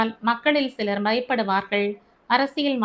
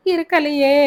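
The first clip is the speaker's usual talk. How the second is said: higher, louder wailing cry; wailing mother; 5 semitones higher, 6 dB louder than usual. Acted. Mother wailing her answer to Albert, higher and louder. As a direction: cry it out loud in anguish. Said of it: "என்று கதறிய".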